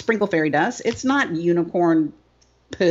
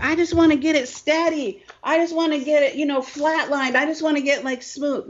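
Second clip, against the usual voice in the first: much higher, whiny mock plea; mock business owner; 7 semitones higher than usual. Speaker mocking business owners who crave steadiness, high and whiny. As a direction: play the pleading owner begging for calm. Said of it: "we're like".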